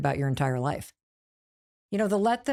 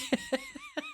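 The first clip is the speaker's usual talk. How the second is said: high squeaky laugh; 9 dB quieter than usual. Real, not acted. Laughing at heavy manuscripts.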